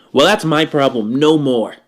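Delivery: ordinary talk, nothing marked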